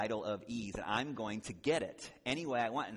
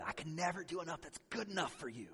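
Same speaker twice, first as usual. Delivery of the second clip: mock blow-up; 7 semitones higher than usual. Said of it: "he just blows up".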